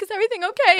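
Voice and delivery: fussing parent. Retold or imitated. Parents checking on their crying daughter, high anxious coo. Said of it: "we're like".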